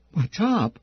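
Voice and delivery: a high startled little question; timid squeak. A narrator's reading. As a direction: squeak it out like a scared kid. Kid startled by demand.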